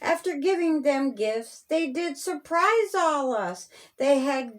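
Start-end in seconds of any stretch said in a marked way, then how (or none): none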